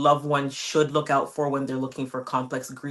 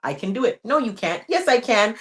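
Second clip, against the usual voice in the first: playful mantra dialogue; 6 semitones higher, 3 dB louder than usual. Retold or imitated. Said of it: "giving yourself mantras".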